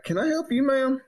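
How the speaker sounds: high, pointed can I help you; sweetly pointed, fed-up politeness; retold mock courtesy, pitched high; mock polite. Confronting neighbor at door.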